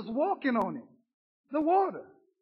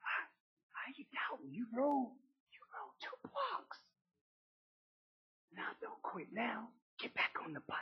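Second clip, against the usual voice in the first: gentle encouragement. Retold or imitated. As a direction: hush and coax them back up. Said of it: "you pick 'em up ... you say".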